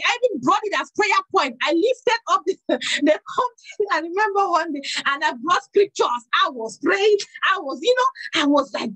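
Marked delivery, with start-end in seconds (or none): none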